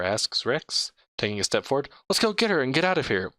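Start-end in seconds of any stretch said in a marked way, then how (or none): 2.1-3.3 eager rush. Acted. Rex rushing the group.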